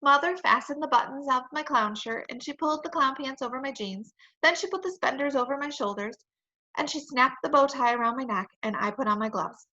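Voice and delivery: ordinary delivery, nothing marked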